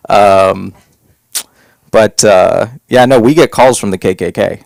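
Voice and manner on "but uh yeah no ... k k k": ordinary talk, nothing marked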